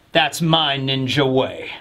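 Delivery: low, slow cool-guy line. Retold or imitated. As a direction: deliver it low and slow, like a badass. Voicing Raizo's cool line.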